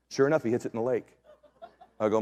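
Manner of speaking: ordinary talk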